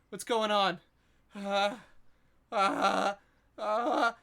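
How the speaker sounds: put-on high, loud, frantic child's voice; a dead boy waking up in terror; panicked boy ghost. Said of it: "and it says".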